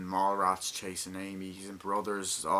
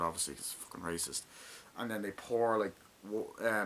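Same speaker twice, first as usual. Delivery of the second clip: ordinary talk, nothing marked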